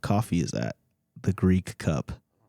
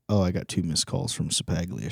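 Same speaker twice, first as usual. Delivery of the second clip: usual speaking voice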